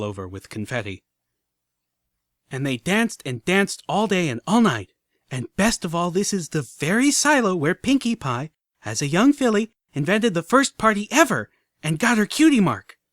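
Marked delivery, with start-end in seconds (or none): 2.5-13.0 high, loud proud storytelling; a filly gushing about her idol; eager little guide. Acted. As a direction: show off every exhibit. Filly voice, tour.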